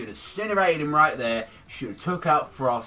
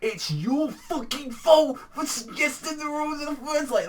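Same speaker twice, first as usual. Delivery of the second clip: much higher, shrill-edged accusing rant; outraged Beerus; 13 semitones higher than usual. Retold or imitated. Beerus blaming Goku for the rules, high mock outrage. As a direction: squeal the blame at him in a mock fit.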